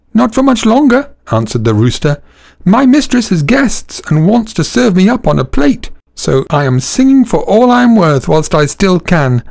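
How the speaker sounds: very high, dramatic rooster voice; doomed rooster. Acted. Rooster telling donkey he'll be served up, very high. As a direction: squawk out your fate.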